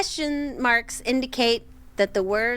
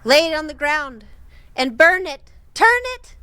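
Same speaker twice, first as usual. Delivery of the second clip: rising louder and higher to a shrill end; eerie command; 6 semitones higher, 7 dB louder than usual. Retold or imitated. Reading the eerie cabin voice, rising to a loud high call.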